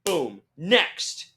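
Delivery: exaggerated rapid-processing call; strict queue handler. Staff moving fans along, in an exaggerated strict call. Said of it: "they were, like, strict".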